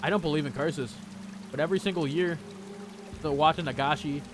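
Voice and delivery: visual novel reading. Acted. Streamer reading visual novel lines aloud, low and even.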